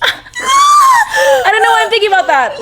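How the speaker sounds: high, tearful cry through a laugh. Real, not acted. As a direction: really break into tears while talking. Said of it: "Why am I crying?".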